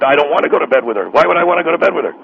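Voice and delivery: ordinary talk, nothing marked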